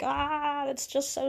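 high, slow, whiny mock lament; mock wailing. Crying man in the lore complaining to God, mock wailing. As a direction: wail like a weeper begging God. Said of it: "he just cries and cries forever".

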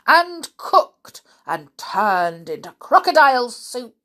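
loud, high, slow scolding; indignant curse. Acted. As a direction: spell out the curse with outrage. Cursing the wicked crocodile.

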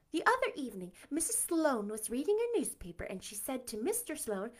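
usual speaking voice

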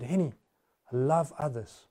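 God quietly answering Henny; gentle voice of God. Acted. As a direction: speak it softly, like a patient God. God interrupts the preacher.

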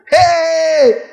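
high, drawn-out hey; astonished exclamation. Acted. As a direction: exclaim high in mock disbelief.

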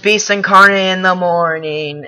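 high, very loud podcast intro; loud show opening. Real, not acted. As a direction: belt out the show's greeting. Opening his show loudly.

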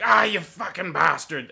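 loud, high yelp of rage; acted outburst over broken knuckles; pained angry curse. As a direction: curse as if you just punched a wall. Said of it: "and's like".

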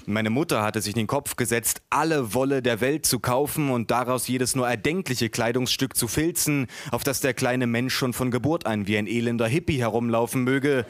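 ordinary delivery

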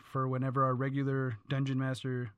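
usual speaking voice

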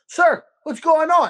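drawn-out, sugary "Sir" to an annoyed customer; exaggerated polite greeting. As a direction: greet him with overdone politeness. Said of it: "Very casual".

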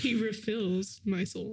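slow, solemn mock scripture; slow pretend-pious line; mock psalm reading. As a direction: intone it like a half-joking preacher.

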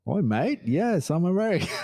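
high, hammed-up fake Australian accent. Answering a drunk Brit at a Dubai bar in a fake Australian accent. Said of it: "My fake accent of Australian".

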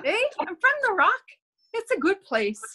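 put-on high-pitched Newfoundland accent; Newfoundlander impression. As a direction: play a hammed-up Newfoundlander. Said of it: "he was probably more like".

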